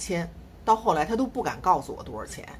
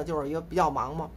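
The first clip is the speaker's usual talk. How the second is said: ordinary talk, nothing marked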